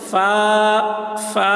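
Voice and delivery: فا with air let out on the f; FAA, breath on the f, slightly held; airy f. Shown as a demonstration. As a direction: blow air through the f of فا, short stretch. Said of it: "تو ہوا نکلتی ہے".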